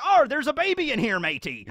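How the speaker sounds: high, loud pirate exclamation; hammed-up pirate surprise; surprised pirate. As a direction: gasp it like a pirate finding loot. Pirate discovers baby.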